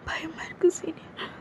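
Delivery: soft, high, crying whisper; frightened teary whisper. Acted. Junior telling her senior she is scared, crying whisper. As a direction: whisper through tears, terrified.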